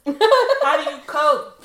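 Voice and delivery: high, loud, slow laughing question. Real, not acted. Asking her friend how she copes, laughing.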